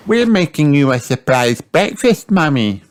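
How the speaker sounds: Peppa voice. A narrator's reading. Peppa explains to Mummy.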